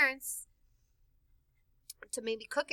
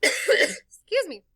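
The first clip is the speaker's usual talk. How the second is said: ordinary delivery, nothing marked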